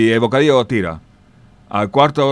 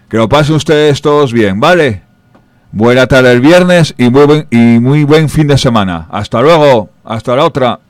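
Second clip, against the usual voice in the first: loud sign-off; 3 semitones higher, 8 dB louder than usual. Real, not acted. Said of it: "signs off to listeners".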